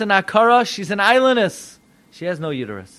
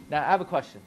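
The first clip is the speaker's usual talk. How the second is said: normal talking voice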